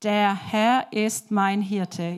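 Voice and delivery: slow, evenly spaced words, "Der - Herr - ist - mein - Hirte". Shown as a demonstration. Showing children the five-finger verse, word by word. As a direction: count out each word slowly. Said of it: "Und er sagt es genau so".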